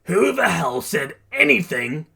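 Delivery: loud, high challenge; cocky retort. A narrator's reading. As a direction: throw it back boldly. Bakugo cockily answers in battle.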